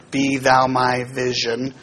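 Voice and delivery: "Be thou my vision" read slowly, syllable by syllable; slow meter demo. Shown as a demonstration. Teacher reading the hymn line slowly to show meter. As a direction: count the ten beats out slowly. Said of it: "There's ten".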